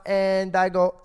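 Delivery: ordinary talk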